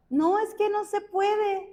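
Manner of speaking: high-pitched protest, as if making excuses; protesting objection. Retold or imitated. Believer telling God it cannot be done, high protesting objection.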